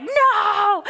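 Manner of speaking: high, loud mock toddler refusal; toddler tantrum. Mom imitating her daughter refusing her shoes, tantrum. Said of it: "she's just crying and whining".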